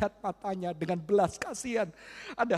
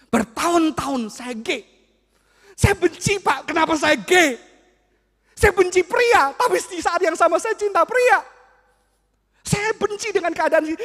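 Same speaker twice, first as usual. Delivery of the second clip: voicing a distraught teen, raised and loud; pleading, pained voice of the boy; anguished youth; 7 semitones higher, 7 dB louder than usual. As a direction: cry out the boy's hatred of himself.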